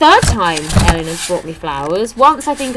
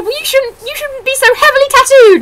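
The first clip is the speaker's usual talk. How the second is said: much higher, louder mock comeback; high mock retort; 8 dB louder than usual. Comedian firing back at the fan, high mock retort. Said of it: "And he said".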